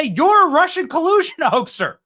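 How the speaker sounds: hammed-up gotcha at the moderator; mock confrontation. Shown as a demonstration. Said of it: "Go to Kristen Welker and say".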